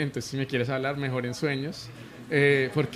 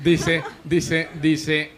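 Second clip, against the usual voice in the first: laughing; 2 semitones higher, 5 dB louder, much slower than usual. Real, not acted. Laughs while restarting the sentence.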